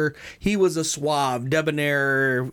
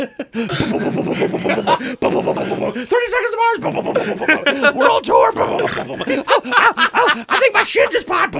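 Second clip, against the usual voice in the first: much higher, louder, slow wailing through laughter; laughing mock pain; 7 dB louder, slower than usual. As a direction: wail the band name while laughing. Leto voiced falling in pain.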